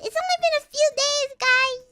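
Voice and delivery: high, loud and fast; loud exasperated. Real, not acted. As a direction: blurt it out in protest.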